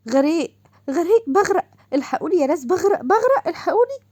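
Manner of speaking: high, loud cries of drowning; hammed-up panic of a girl soaked awake; mock panicked screaming. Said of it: "وتصرخ".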